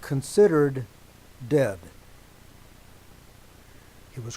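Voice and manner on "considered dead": considered drawn out and hit loud, then dead; stress on considered. Shown as a demonstration. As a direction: lean hard on considered. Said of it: "I'm gonna emphasize the word".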